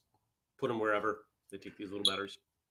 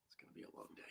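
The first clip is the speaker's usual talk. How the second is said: breathy whisper, almost silent; 22 dB quieter, much faster than usual. Real, not acted. To the viewers, dreading the long day ahead, whispered. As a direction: murmur it under your breath, weary. Sighing over the coming day.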